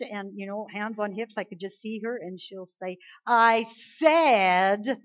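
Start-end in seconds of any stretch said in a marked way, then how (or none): 3.2-5.0 put-on stern little-girl demand; loud stubborn toddler. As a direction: hands on hips, insisting like a toddler. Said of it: "the little voice goes".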